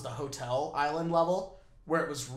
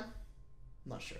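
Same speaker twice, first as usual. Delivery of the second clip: slow, whispered musing; soft, breath-only drawl; hushed uncertainty; 2 semitones lower, 16 dB quieter, much slower than usual. Pretend. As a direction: draw it out in a whisper. Hushed, drawn-out answer to self.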